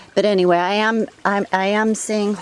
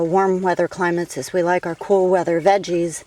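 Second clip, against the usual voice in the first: ordinary delivery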